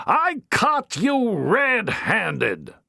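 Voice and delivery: high, slow, cold accusation; furious matron. Acted. Matron accuses Harry, slow and furious. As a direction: nail him with each word. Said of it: "the furious matron".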